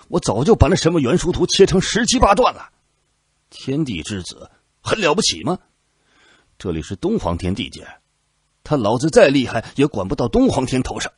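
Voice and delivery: high, loud furious rant; angry cursing. A narrator's reading. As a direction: vent the rage in a tirade. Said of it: "蓝大将军怒骂".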